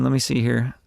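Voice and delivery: ordinary talk, nothing marked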